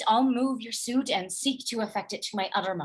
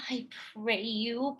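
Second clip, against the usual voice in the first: whisper; 8 dB quieter than usual. Acted. Unvoiced whispered plea.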